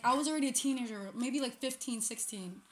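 usual speaking voice

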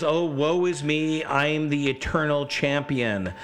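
slow, high self-pitying moan; drawn-out parody of a whiner; mock whining. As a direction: wallow in hammed-up woe. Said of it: "What a whiner".

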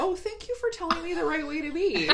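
high, sarcastic thank-you voice; sarcastic gushing thanks; mock grateful reply. Friend mocking people who get put in their place, mock gratitude. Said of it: "They're like".

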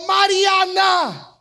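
angry shout. Retold or imitated. Annoyed father yelling at daughter, angry shout.

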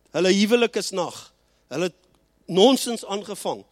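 ordinary talk

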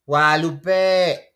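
drawn-out shouted name, very slow; long calling shout. Shown as a demonstration. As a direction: call the name across the house, stretching it. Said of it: "Así".

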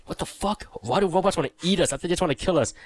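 a retold, lightly acted fright; mock panicked disbelief. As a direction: sound lost and scared in hiding. Retold panic while hiding.

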